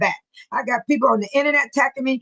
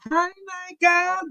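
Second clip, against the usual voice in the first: usual speaking voice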